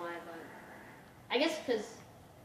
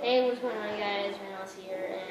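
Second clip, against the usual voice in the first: low-key gamer intro impression, trailing lower and softer; Vanoss; 6 semitones lower, 4 dB quieter than usual. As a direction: lightly imitate a low-voiced video greeting. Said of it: "do your best Vanoss impression".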